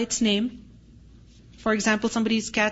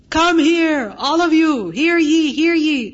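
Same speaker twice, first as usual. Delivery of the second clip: loud proclamation, pitched up; town crier; 4 semitones higher, 5 dB louder, slower than usual. Shown as a demonstration. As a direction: call the crowd like a herald.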